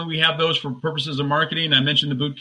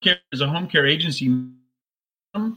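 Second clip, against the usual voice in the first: choppy, glitchy call audio; breaking up like a bad connection. Real, not acted. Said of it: "the audio is breaking up a bit".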